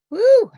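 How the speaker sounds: high, loud "woo!"; excited whoop. Real, not acted. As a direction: let out a big celebratory whoop. Cheering the start of session.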